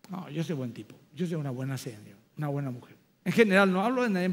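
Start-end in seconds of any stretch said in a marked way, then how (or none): 0.1-3.0 smug self-righteous. Retold or imitated. Mocking self-righteous people.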